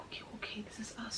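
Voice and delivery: very soft, whispery murmur; quietly steady yourself under your breath; hushed self-reassurance. Retold or imitated. Reassuring herself as the plane comes down, hushed.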